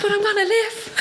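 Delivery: very high, excited, with laughter; excited retold outburst. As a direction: retell joyful news in a high laughing squeal. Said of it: "screaming on the phone, excited".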